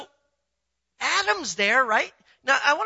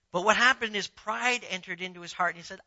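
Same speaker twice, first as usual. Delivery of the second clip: ordinary talk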